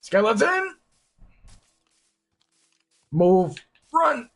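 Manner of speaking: shrill high panicked shouts; panicked yelling. Real, not acted. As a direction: scream warnings while fleeing. Skeleton chasing in forest.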